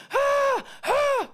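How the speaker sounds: very high mock crowd scream. Whole assembly hall screaming at the star on stage. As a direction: squeal like a starstruck school crowd.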